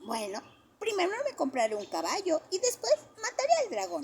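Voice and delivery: put-on high, eager child voice; a small boy sure of his plan; eager little boy. A narrator's reading. Johnny tells the kids his plan, eager little boy voice.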